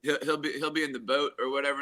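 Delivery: ordinary delivery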